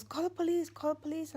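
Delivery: high, urgent repeated plea; retold panicked urging. Son begging mother to call the police, retold panic. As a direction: press her hard to call right away.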